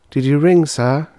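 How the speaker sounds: high, polite service voice; polite stewardess. Acted. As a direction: ask sweetly as stewardess. Stewardess answers call.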